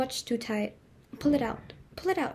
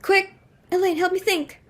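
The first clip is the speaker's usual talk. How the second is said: flustered plea; 7 semitones higher, 4 dB louder than usual. Acted. Hostess panicking over guest count.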